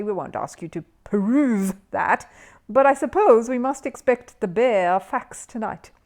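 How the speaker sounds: slow, showy quizmaster voice; milk the pun, slowly; punning host. Acted.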